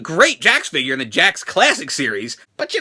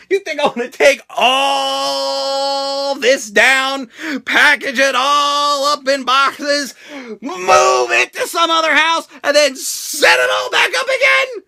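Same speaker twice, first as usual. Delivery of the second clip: exasperated rant; 5 semitones higher, much slower than usual. Real, not acted. Vlogger ranting to viewers about moving his toys, slow rising exasperated shout.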